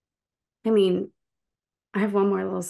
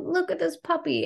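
mocking cooing tourists; 11 semitones higher than usual. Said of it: "the contrast of them being like".

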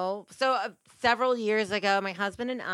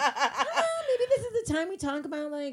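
mock naive musing; 6 semitones higher than usual. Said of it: "I'm just like".

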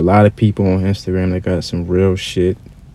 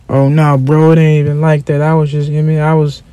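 higher-pitched, wheedling excuse; mock backpedaling; 5 semitones higher than usual. Online gangster met in traffic, backpedaling. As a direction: imitate a caught-out faker making excuses.

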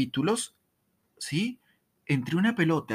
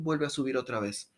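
ordinary talk, nothing marked

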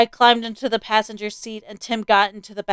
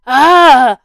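startled exclamation; 4 semitones higher, 10 dB louder than usual. A narrator's reading. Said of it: "Dad said".

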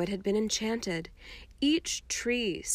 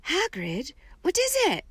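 rising sharply much higher; shocked, fearful question; aghast; 8 semitones higher than usual. A narrator's reading. Hermione aghast at sobbing Hagrid, sharply higher.

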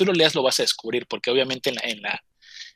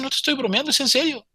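high-pitched retold protest; imitating an urgent protest; earnest insistence; 10 semitones higher than usual. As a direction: insist on being believed, pitch raised. Insists the confession is true.

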